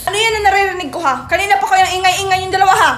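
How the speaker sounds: loud, high, rapid telling-off; scolding mother. Acted. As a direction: demand answers from two noisy kids. Mother scolds the noisy siblings.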